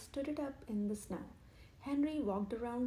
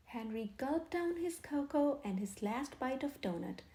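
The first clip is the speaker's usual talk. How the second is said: ordinary delivery, nothing marked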